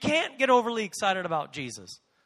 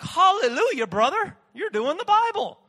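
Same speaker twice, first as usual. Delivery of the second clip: high, loud hammed-up hallelujah; imagined churchgoers cheering in jest; mock praise; 11 semitones higher, 9 dB louder than usual. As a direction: ham up an overjoyed believer. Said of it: "they wouldn't be like".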